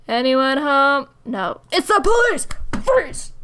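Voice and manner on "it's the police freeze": police shout. Pretend. Calling out as police officer.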